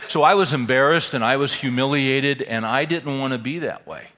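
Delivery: loud, high, emphatic delivery. Real, not acted. Speaker telling the audience he was humiliated, loud and emphatic. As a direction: push it out forcefully over the laughter. Raises voice over audience laughter.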